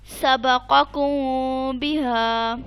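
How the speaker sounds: फहकुम बैनहुम recited very slowly, मीम hummed through the nose; slow Quranic chant, nasal ghunna held on मीम; ghunna recitation. Shown as a demonstration. Teacher reciting for her student, slow nasal ghunna on मीम. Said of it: "इसे कहेंगे इख्फा".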